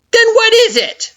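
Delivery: very high, loud demand; impatient Flowey. A narrator's reading. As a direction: snap the question.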